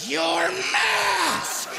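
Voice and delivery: 높고 날카로운 할머니 외침; shrill old lady shout. Retold or imitated. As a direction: yell it like a scandalized old lady. Old lady yells at him.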